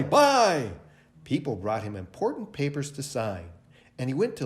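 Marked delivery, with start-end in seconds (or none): 0.0-0.7 high, louder repeat of the call; businessman calling out. A narrator's reading. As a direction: keep the trader's call going. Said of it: "sometimes he said".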